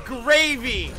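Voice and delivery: high, drawn-out mock excitement; hammed-up excited exclamation. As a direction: sing out each word, pitched high. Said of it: "said the Kiriko excitedly".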